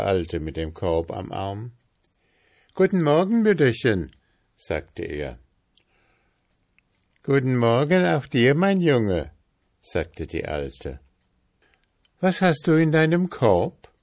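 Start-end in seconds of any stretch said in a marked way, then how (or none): 2.7-4.1 high, loud friendly greeting; cheerful young lad. A narrator's reading. Lad greets an old woman, cheerful young voice. 7.2-9.3 high, loud, quick friendly reply; old woman's lively greeting, pitched up; old woman answering. A narrator's reading. Old woman greets the boy back, lively high voice. 12.2-13.9 high, loud, curious question; curious young lad. A narrator's reading. Lad asks about the basket, curious and high.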